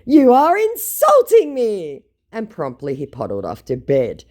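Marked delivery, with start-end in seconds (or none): 0.1-2.1 high, loud, slow mock outrage; offended scammer. Scammer tells her he is insulted, mock-outraged voice. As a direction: draw it out in hammed-up affront.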